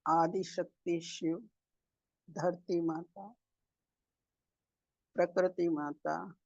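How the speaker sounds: soft, unhurried meditation guidance with long pauses; guided meditation. Real, not acted. Meditation leader guiding masters to sit and breathe, calm guided meditation. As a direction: settle the group gently into stillness, phrase by phrase.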